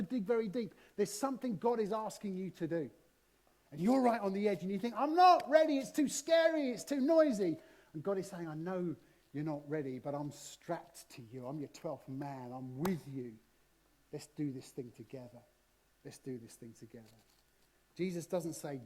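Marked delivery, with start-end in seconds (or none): 4.9-7.6 loud, high panicky protest; scared excuse. Acted. Listener on the edge crying out in fear, high and loud. 8.5-17.0 God reassuring. Acted. God promising the frightened listener to jump together, softly.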